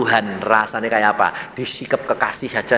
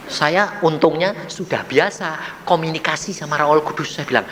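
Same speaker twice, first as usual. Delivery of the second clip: normal talking voice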